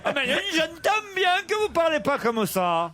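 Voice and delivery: high, hammed-up Midi accent; southern French accent. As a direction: put on an exaggerated southern French lilt. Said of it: "c'est votre accent du Midi".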